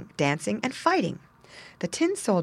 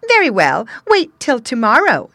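loud, higher menacing imp; giggly sneering imp; 6 semitones higher, 10 dB louder than usual. A narrator's reading. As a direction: threaten him with glee. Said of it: "said the imp".

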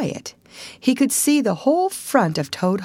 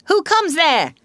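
high, sharp sentry's challenge; 9 semitones higher, 5 dB louder than usual. A narrator's reading. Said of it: "said the ferret sharply".

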